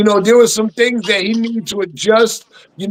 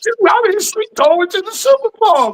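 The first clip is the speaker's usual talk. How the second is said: fired up, pitch jumping high; all-in hype about his team; real excited outburst, high and fast; 9 semitones higher than usual. Real, not acted. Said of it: "All right, [name], calm down".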